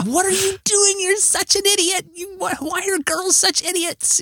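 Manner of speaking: imitating an exasperated wife yelling, pitch way up; mock yelling. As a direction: scold the TV in a high mock yell. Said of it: "yelling at the screen".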